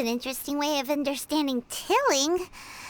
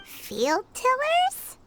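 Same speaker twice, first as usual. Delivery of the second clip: slow, rising, pitched-up question, drawn out in disbelief; puzzled echo; 7 semitones higher, much slower than usual. Acted. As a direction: repeat the odd name back, baffled. Echoing a strange new name.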